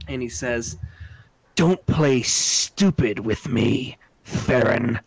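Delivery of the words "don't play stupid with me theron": slow, louder, menacing growl of a grudge; menacing threat. Acted. Old enemy with a dagger threatening Ragnar, slow and menacing. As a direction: lean in and threaten him slowly.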